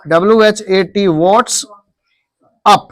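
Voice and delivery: normal talking voice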